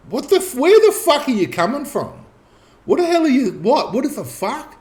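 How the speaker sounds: very high, incredulous retold outburst; bewildered reaction. Prisoner baffled by two guys thanking him, high bewildered splutter. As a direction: splutter it high-pitched, restarting in disbelief.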